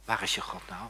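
low, soft taunting question; quiet taunt. Retold or imitated. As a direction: needle them quietly.

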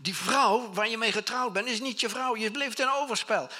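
nagespeelde schreeuwende beschuldiging, hoog en luid; Johannes. Retold or imitated. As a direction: klaag hem aan voor het volk. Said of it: "Hij stond te schreeuwen". (luid geschreeuwde aanklacht)

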